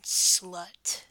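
loud, punched single word; forceful name-calling. Pretend. Dominant claiming a partner, loud punched word. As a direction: throw the word out hard.

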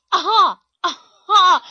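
high, loud victorious cry; triumphant shout. Acted. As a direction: crow over the find.

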